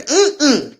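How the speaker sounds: firm no-nonsense refusal, lightly voiced; mock sassy brush-off. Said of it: "I always used to say".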